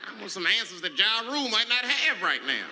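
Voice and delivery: loud, high and fed up; exasperated rant. Real, not acted. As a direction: yell at the TV in disbelief.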